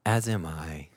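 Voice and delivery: dry, low sarcastic reply. Acted. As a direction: say it deadpan and sarcastic. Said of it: "Did you hear the sarcasm in my voice?".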